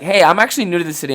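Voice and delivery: fast, loud chirpy opener; fast, loud hello to a stranger at a bar; mock friendly greeting. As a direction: burst out a bright hello to strangers. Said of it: "be like".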